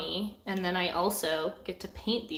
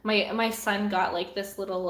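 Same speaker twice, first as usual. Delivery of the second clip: ordinary delivery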